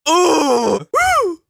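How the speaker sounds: high, loud delighted cheering; excited whoops. Real, not acted. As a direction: whoop with real thrill. Thrilled by the performance.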